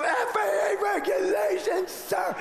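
high shouted warning; frantic steward. Retold or imitated. Steward shouting the rule at the passenger, high panicked yell. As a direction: shriek the rule at him. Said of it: "the attendant".

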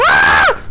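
loud panicked scream. Real, not acted. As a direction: let out a sharp scream. Scream while dying in game.

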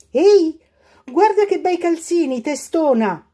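high, loud scolding call; mock angry mother calling her daughter; angry mother's shout. A narrator's reading. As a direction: yell at her like a cross mum. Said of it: "gridava la mamma arrabbiata".

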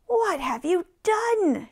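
high, loud, drawn-out scolding; loud storybook telling-off, words stretched; angry shout. A narrator's reading. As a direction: shout it slowly in outrage. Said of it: "shouted Crooked Root angrily".

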